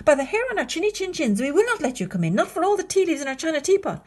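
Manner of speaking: high, loud, quick cub chant; loud high wolves refusing; defiant little wolves. Acted. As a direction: rattle it off bravely. Wolves defy pig.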